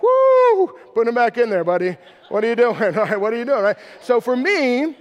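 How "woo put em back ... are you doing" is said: hammed-up church elder telling a boy to drop his hands; old-school church scolding. Pastor imitating his old-school church scolding him, loud and mocking. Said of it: "it was like".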